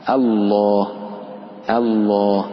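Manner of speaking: الله slowly, clean a then dark, long laam; light hamza. Shown as a demonstration. Teacher repeats the correct form, الله with light hamza. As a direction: keep the first a of الله thin, the laam heavy. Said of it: "إنما هو آ".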